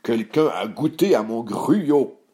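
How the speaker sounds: slow, growled bear voice; growling Papa Bear. A narrator's reading. Papa Ours finding his porridge tasted, slow growl. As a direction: growl it slowly as father bear. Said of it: "grogna Papa Ours".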